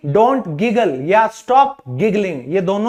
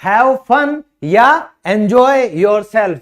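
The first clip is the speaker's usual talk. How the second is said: slow model phrase; much slower than usual. Shown as a demonstration. Teacher modelling phrases for learners, slow. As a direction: have fun and enjoy yourself said very slowly. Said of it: "तुम मजे करो".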